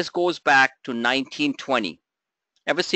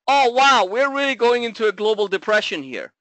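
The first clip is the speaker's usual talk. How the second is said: mock alarm; 5 semitones higher than usual. Host mocking doom-sayers to his trading members, with hammed-up sarcastic alarm. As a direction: play up a pretend market crash. Sarcastic gasp at the market chart.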